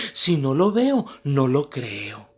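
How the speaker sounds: slow, drawn-out skeptical quip; doubtful old woman. Acted. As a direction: drag out the last part in disbelief.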